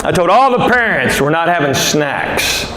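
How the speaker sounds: ordinary delivery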